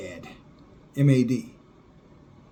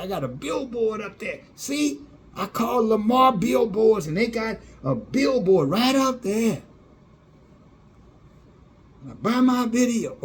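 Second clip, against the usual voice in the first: mocking huckster; 6 semitones higher than usual.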